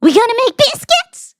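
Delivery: hammed-up Southern cook; Southern accent. Shown as a demonstration. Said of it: "Would you prefer I do".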